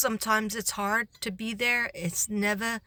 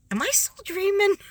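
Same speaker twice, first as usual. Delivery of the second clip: high, loud, amazed question; mock disbelief; 13 semitones higher, 5 dB louder than usual. Teacher stunned by the secretary's praise, high and amazed. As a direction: squeal it out like you can't believe it.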